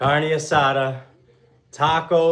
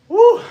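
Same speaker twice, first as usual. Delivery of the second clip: high excited whoop; 14 dB louder than usual. Real, not acted. Celebrating finished set.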